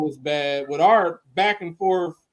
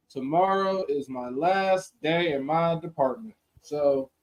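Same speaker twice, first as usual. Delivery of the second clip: usual speaking voice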